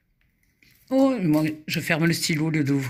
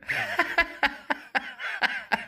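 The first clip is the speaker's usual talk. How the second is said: high laughing fit; 9 semitones higher, 6 dB quieter than usual. Real, not acted. Laughs after winning again.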